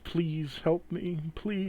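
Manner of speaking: high, slow, pleading voice of a wounded dog. Acted. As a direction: plead softly as a dying dog given words. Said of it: "you hear the words".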